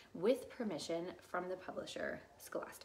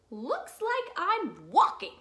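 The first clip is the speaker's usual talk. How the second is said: loud, slow, higher-pitched grumble; grumpy bird; 10 semitones higher, 11 dB louder, slower than usual. Acted. Bird too grumpy to fly, loud drawn-out grumbling. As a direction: grumble it out with exaggerated swoops.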